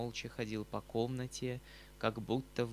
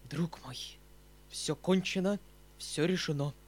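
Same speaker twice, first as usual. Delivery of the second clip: understated tragic declaration, pitched up; 6 semitones higher, 4 dB louder than usual. A narrator's reading. Uncle telling his nephew all is decided, tragic. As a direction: announce doom with a tragic air. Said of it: "каким-то трагическим полушёпотом".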